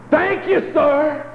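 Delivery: high shouted thank you; loud shout of thanks. Retold or imitated. Old man rearing back to thank God, high shout. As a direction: rear back and holler it to heaven. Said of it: "rear back and say".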